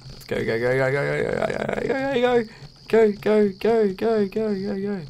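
rising frantic chant of go; urgent high-pitched go go go. Real, not acted. Fleeing, urging escape.

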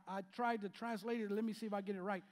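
ordinary talk

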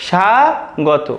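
স্বাগত stretched out very slowly as "sha-go-to"; slow model word. Shown as a demonstration. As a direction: draw out স্বাগত as "sha-go-to", falling. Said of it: "আমাদের সঠিক উচ্চারণটি হবে".

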